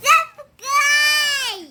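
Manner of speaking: high, loud shouted subscribe, slow; excited shout. Real, not acted. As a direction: yell subscribe with all your might. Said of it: "Trishu कैसे प्यार करती है बता दो तो।". (बच्ची का ज़ोर से चिल्लाकर सब्सक्राइब कहना)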